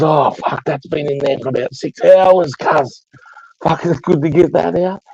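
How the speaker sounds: high-pitched mock relief; exaggerated relief in a high voice; imitating relieved cousin. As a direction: retell it as the cousin, pitched right up. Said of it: "He goes".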